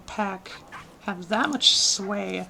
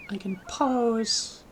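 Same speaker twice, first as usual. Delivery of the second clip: ordinary talk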